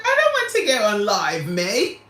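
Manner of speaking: retold British refusal; playful British mimicry; imitating cousin's British accent. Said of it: "British accent is so dope".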